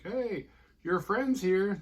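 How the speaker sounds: high, drawn-out mock call; playfully stretched, high-pitched announcement; singsong calling out. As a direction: call out slowly like an aunt announcing company. Aunt calls out to the kids.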